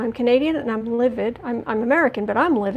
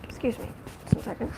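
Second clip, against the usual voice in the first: whispered aside; 7 dB quieter, faster than usual. Real, not acted. Aside while about to cough.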